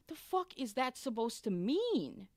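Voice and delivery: slow, high, loud retold affront; indignant. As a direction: draw it out, loudly offended. Retold offence at staff's remark.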